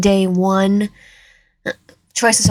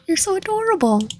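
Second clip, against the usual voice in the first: put-on high sweet yandere girl voice; cute high voice; 10 semitones higher, 3 dB quieter than usual. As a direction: play the doting girl, pitched way up. Doting yandere line.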